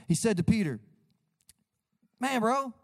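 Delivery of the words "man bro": high, loose bro-speak Jesus; Jesus as a laid-back bro; casual Jesus voice. Retold or imitated. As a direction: paraphrase Jesus like a buddy. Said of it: "is my translation".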